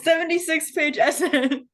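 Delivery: laughing through the words. Real, not acted. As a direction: crack up as you say it. Joking, laughter heard.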